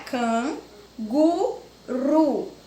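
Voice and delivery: "can, gu, ru" as three beats with pauses; syllable by syllable. Shown as a demonstration. Teacher dictating a three-syllable word, syllable by syllable. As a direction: separate "can", "gu", "ru" one at a time. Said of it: "três sílabas é trissílabas".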